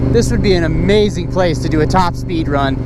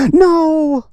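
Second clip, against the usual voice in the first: high, gasping "no"; gasp, then cry out in dismay; 8 semitones higher than usual. Real, not acted. Vlogger seeing the ladybug drop, high dismayed gasp.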